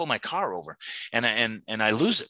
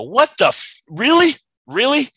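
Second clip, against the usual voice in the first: much higher, much louder outraged cry; exasperated shout; 10 dB louder than usual. Retold or imitated. Cursing at God over his daughter's news, shouting in outrage. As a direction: yell up at the sky in disbelief. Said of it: "I go to my default and I go".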